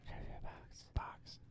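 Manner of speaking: eerie whisper of the title; whispered jingle. Acted. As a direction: breathe the title softly.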